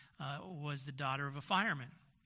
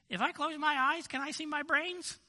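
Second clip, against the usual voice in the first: put-on high squeaky little boy; squeaky kid voice; 10 semitones higher, 4 dB louder than usual. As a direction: pitch it up like a small child. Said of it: "he has a squeaky voice".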